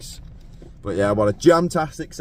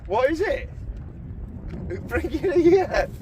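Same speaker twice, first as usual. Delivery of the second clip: excited; 14 semitones higher, slower than usual. Real, not acted. Excited demand for the catch.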